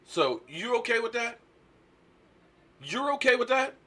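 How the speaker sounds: rhetorical challenge. Real, not acted. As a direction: press them for an answer.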